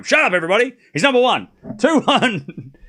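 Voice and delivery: mock shout. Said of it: "I'll say it for him".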